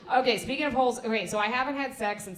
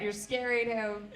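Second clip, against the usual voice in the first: ordinary talk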